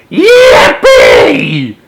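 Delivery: high, loud joyful yell. Acted. Grandpa Joe yelling to Charlie, loud and high. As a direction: shout it with pure glee. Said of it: "he yelled out".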